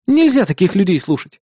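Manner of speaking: plain reading voice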